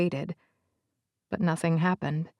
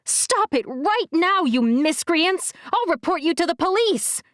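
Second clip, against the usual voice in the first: enraged outburst; 9 semitones higher than usual. A narrator's reading. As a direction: scold the boys in outrage. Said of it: "my face frozen in a rictus of rage".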